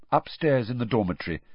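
usual speaking voice

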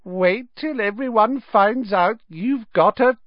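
put-on high, slow, gloating ghost girl; guffawing ghost.

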